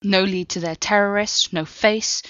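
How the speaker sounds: usual speaking voice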